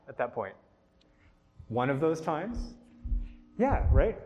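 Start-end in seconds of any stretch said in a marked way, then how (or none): 1.7-2.7 "One of those times" with a rising, questioning end; questioning echo. Shown as a demonstration. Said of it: "just asking it in question form".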